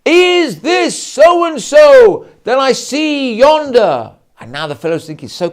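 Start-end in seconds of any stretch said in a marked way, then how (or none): 0.0-4.1 very high, loud, slow theatrical call; booming stage declamation. Acted. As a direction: declaim it to the back of the hall. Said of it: "the feeder line from his fellow actor".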